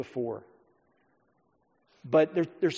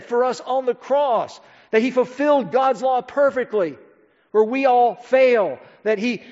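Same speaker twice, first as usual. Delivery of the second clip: louder, higher, emphatic preaching; fervent preaching; 6 semitones higher, 6 dB louder than usual. Acted. Pastor urging the congregation to believe, full-force preaching. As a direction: drive each phrase home to the pews.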